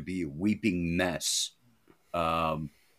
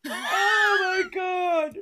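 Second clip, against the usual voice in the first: slow, much higher laughing shriek; 4 dB louder, much slower than usual. Real, not acted. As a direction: squeal it out, dying of laughter. Friends cracking up at reader.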